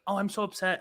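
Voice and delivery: a hint of a whiny girl; mock sad girl. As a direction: lightly play the upset girl. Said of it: "there was this one girl that was just like".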